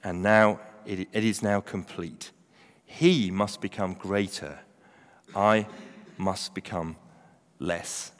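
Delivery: low, hushed, slow reading; solemn scripture reading. Acted. Preacher closing John's reply to his followers, slow and solemn. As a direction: let each phrase land softly, with pauses.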